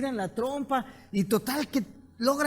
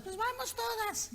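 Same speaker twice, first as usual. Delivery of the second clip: very high, soft little squeak; tiny ant voice; 13 semitones higher, 9 dB quieter than usual. Acted. The ant complains to her friends at the anthill in a tiny squeak. Ants rally together.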